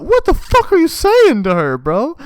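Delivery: high, loud mock fury; imitated angry outburst. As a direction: shout it up high, imitating an outraged woman. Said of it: "Like, getting all mad".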